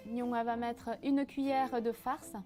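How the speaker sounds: ordinary talk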